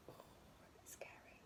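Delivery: mock scared whisper. A narrator's reading. Teacher reacting to the dark forest in a mock scared whisper. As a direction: whisper it like it's spooky.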